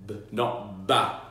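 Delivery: B short with no vowel after; clipped b. Shown as a demonstration. Teacher showing clean B sound, no added vowel. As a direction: stop the B dead without ba. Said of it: "we want the children to go".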